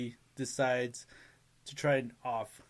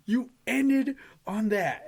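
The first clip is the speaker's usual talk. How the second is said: slower, stretched-out outrage, falling at the end; mock-outraged, drawn-out exclamation; heated disbelief; 9 semitones higher, 5 dB louder, slower than usual. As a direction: spell out the indignation in a high, loud voice. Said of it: "I remember being heated".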